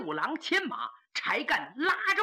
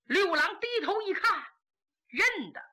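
ordinary delivery

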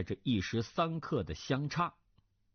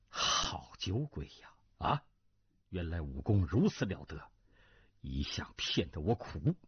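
prince's inner realisation; 8 semitones lower than usual. Acted. Prince privately discovering a drunkard's hidden skill, low musing. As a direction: muse to yourself, pitched well down.

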